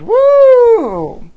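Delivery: very high, loud party whoop; shrieked pride cheer. Real, not acted. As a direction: cheer it like a parade float. Cheering Happy Pride sign-off.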